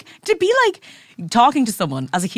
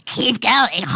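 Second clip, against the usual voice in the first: creepy Gollum trainer. Retold or imitated. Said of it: "She's like".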